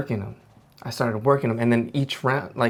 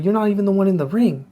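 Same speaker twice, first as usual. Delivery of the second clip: high, loud warning; coach confronting; 8 semitones higher, 5 dB louder than usual. Retold or imitated. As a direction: square up to the dad. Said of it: "he's like".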